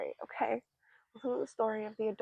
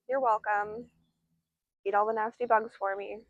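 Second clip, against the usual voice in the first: normal talking voice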